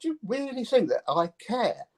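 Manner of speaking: high, laughing, mock-dismissive question; dismissive shrug. Musician brushing off hecklers, high and laughing. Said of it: "That strange thing is like".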